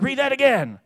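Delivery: high, loud eager demand; excited. Retold or imitated. Dad eagerly asks his son to reread, high and loud. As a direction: urge him to read it again.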